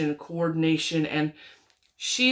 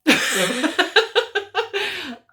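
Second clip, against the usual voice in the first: loud, high burst of laughing; burst of laughter; 12 semitones higher, 7 dB louder than usual. Real, not acted. Aunt recalls baby niece smelling yarn, bursting into laughter. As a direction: crack up loudly, high-pitched. Cracks up at the baby's face.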